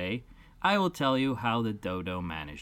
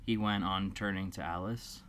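ordinary delivery

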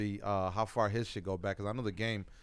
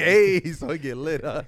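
laughing; 4 semitones higher, 10 dB louder than usual. Real, not acted. Laughing reply.

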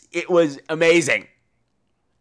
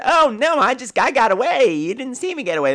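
mock cliche escape; 8 semitones higher than usual. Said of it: "the standard".

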